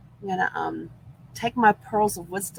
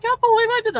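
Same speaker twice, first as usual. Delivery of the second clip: disbelief; 6 dB louder, faster than usual. Retold or imitated. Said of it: "I was like".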